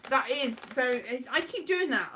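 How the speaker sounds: ordinary talk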